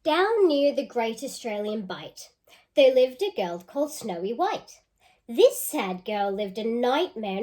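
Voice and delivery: lively storybook reading; reading to children. Acted. Teacher reading a picture book aloud to children. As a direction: read it out with relish for the kids.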